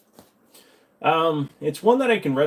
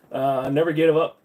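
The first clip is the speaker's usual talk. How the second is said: usual speaking voice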